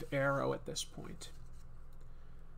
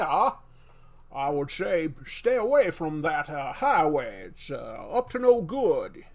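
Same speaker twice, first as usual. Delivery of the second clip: slow, loud New England drawl; loud put-on New England accent; 6 dB louder, slower than usual. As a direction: drag the vowels out like an old Yankee. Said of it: "He sort of did the weird, he did a New England accent with a drawl".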